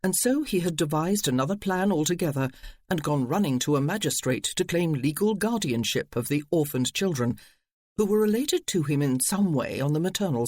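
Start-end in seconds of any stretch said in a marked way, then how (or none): none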